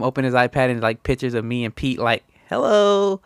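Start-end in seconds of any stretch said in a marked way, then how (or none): 2.5-3.2 high, louder playful hello; goofy sing-song hello; mock greeting. Imitating greeting in the photos.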